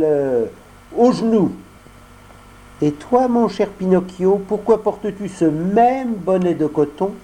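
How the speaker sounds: put-on fibbing boy turning the question; voix de Lumignon. Lumignon ment, puis questionne. (voix d'enfant qui ment)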